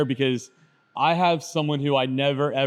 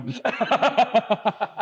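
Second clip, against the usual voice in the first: high-pitched real laughter; amused laugh; 8 semitones higher than usual. Real, not acted. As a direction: burst out laughing at your own teasing. Joking about the crown.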